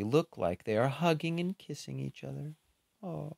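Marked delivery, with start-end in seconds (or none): none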